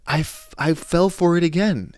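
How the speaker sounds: high, loud I fell for it again; admitting to listeners he got fooled, exasperated; exasperated at himself. Real, not acted. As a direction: groan at your own gullibility. Self-exasperation.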